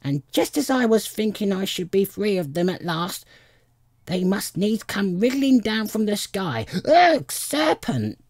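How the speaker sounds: high rant climbing to a screech; rising to a shriek. A narrator's reading. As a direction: build from complaint to a shrieked last word. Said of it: "raising its voice to a shriek".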